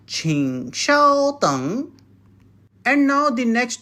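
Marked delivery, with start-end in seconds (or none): 0.1-1.9 请稍等 said slowly to be copied; slow and clear, for repetition. Shown as a demonstration. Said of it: "Once more".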